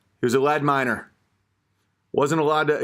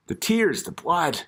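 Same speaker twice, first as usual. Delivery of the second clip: ordinary talk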